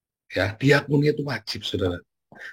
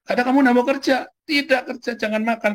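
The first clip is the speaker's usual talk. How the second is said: preaching high; 11 semitones higher than usual. Acted. Preacher hammers the command.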